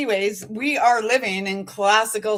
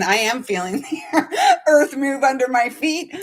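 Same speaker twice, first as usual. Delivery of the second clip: spoken through a laugh; 4 semitones higher than usual. Real, not acted. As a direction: say it plainly but break into giggles midway. Spoken restatement of the sung line.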